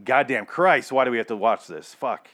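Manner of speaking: usual speaking voice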